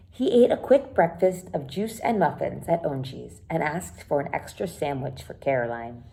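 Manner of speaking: usual speaking voice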